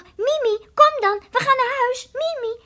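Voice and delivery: loud, high call for the cat. Retold or imitated. Bims calling Mimi back from the water, loud and high. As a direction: call her home over the wind. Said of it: "Ik riep nog".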